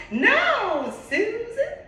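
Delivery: high, loud Southern Georgia drawl; exasperated Southern Georgia accent, slow and loud; Mary's Georgia accent. Retold or imitated. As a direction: do Mary's Georgia accent, aghast at strong tea. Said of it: "if I'm on point with that accent".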